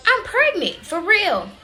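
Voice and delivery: high, loud incredulous cry; imitating her own stunned reaction; shocked disbelief. Hearing the test result, a high stunned cry.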